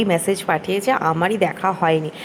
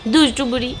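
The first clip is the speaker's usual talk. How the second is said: fond teasing; 4 semitones higher than usual. Real, not acted. Teasing toddler fondly.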